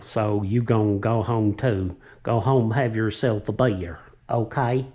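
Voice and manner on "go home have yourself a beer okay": put-on a light, slow silly American accent; lightly put-on American drawl, friendly sign-off. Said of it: "my silly old American accent".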